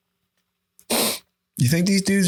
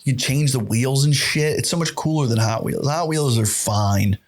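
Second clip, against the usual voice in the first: ordinary delivery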